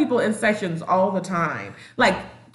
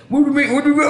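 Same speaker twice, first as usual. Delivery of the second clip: louder, higher, mock indignant double take; 5 semitones higher, 5 dB louder, much faster than usual. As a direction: act offended at the date news.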